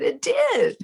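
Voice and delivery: very high, thrilled squeak; giddy agreement. Retold or imitated. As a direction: answer in a delighted high squeal. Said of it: "And I said".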